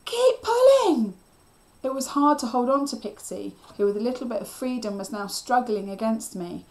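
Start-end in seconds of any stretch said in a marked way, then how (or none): 0.1-1.1 very high, drawn-out yell; loud, stretched, frantic shout; almost hysterical cry. A narrator's reading. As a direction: scream it slowly in desperation. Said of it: "I cried, almost hysterical".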